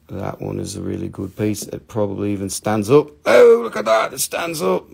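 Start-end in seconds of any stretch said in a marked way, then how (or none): 3.3-4.9 high-pitched burst of delight; wowed, high exclamation; excited. Real, not acted. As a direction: marvel at it, pitch leaping up. Delighted show of crystal standing.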